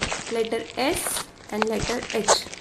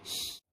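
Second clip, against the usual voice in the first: long unvoiced hushing shh; whispered shh; 16 dB quieter than usual. Shown as a demonstration. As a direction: hush like tiptoeing past a sleeping snake. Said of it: "What sound they were making?".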